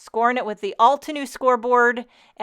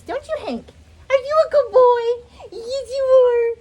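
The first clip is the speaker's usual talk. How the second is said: very high, laughing squeaky pet talk; 7 dB louder, slower than usual. Real, not acted. Owner praising Hank the dog, squeaky laughing baby talk. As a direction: coo at him like a puppy, giggling.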